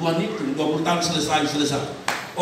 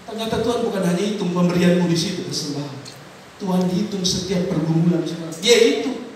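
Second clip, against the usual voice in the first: usual speaking voice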